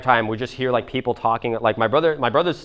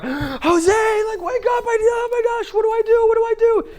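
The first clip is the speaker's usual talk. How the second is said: imitated panicked mom screaming, extremely high; mom going ballistic; slower than usual. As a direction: shriek like a mother waking her son in panic. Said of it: "she's going ballistic".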